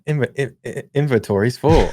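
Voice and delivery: "inventory" chopped into repeated first syllables, then completed; "Inv-, in-, in-" looped like a soundboard, then "inventory's full"; stuttered remix. Shown as a demonstration. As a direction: stutter the start of "inventory" like a sampled beat. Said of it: "in my head, I remix it".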